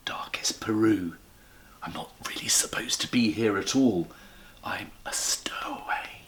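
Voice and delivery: whispering the bear's secret; secretive whisper. Acted. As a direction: hush it like a hiding stowaway. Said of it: "looked round carefully before replying".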